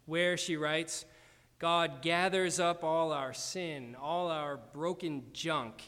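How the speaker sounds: ordinary talk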